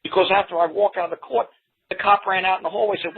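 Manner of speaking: ordinary talk, nothing marked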